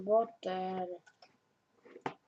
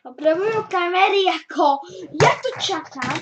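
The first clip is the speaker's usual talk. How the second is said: loud, high, impatient outburst; exasperated shout; 10 semitones higher, 16 dB louder than usual. Real, not acted. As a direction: yell it out in frustration. Impatient outburst at stalled stream.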